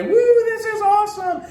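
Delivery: high excited whoop, pitch way up; thrilled yell. Retold or imitated. A boy whooping down from the coaster, high and excited. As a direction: shout it so you're heard a mile away. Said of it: "you can hear him a mile away".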